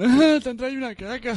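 high, teasing grown-up tone; mocking parent voice. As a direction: tease a kid about a gift. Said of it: "En plan".

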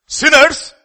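sinners shouted high and loud; shouted word. Real, not acted. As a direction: throw out the word sinners with force. Preacher shouts key word.

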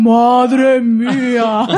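drawn out as if in slow motion; slow-motion exclamation. Retold or imitated. Friends watching the kiss dodge, dragged-out slow motion cry. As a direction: stretch every syllable like a slowed film. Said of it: "Así a cámara lenta".

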